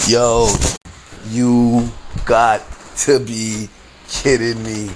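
very slow, each word set apart; slow disbelief. Retold or imitated. Customer seeing who walks in, slow disbelief. Repeats it slowly for emphasis.

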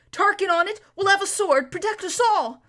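loud breathless call while galloping; panting. A narrator's reading. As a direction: shout it out of breath. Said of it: "panted Bree".

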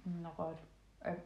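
talking while eating chocolate; mouth full. Real, not acted. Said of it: "sorry, I'm eating chocolate again".

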